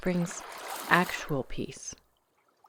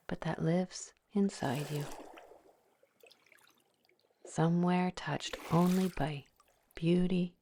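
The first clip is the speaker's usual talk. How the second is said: guided meditation; 5 dB quieter than usual. Acted. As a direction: ease the listener into calm, one breath at a time. Leads a guided visualization.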